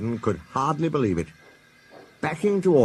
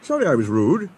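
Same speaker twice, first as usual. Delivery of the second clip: hasty; 11 semitones higher, 3 dB louder than usual. Acted. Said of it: "said Henry hastily".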